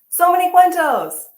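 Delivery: loud and high, thrilled; excited. Real, not acted. Reader thrilled at Anna's new books, excited exclaim. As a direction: burst out with delight.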